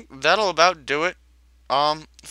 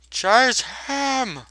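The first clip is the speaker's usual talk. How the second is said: higher, urgent yelled order; urgent shout; 9 semitones higher, 4 dB louder than usual. Acted. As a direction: push them to chase. Repeating the chase order.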